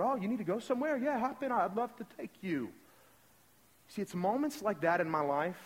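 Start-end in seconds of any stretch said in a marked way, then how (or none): 0.0-2.7 high-pitched, eager helper voice; light, high imitation of a friendly local; little Brazilian guy. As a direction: play a chirpy boatman offering a lift. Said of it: "This little Brazilian guy going".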